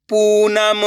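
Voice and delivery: पूनम with a long held oo; drawn-out vowel. Shown as a demonstration. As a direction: stretch the पू in पूनम. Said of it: "बड़ू की मात्रा पढ़ रहे हैं तो खींच के पढ़ेंगे".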